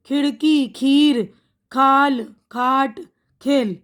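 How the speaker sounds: ordinary talk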